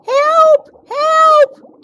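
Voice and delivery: very high, loud squeals; squealed cry for help. Acted. The whole school races toward Birdie, high panicked squeals. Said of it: "came the sudden squeals".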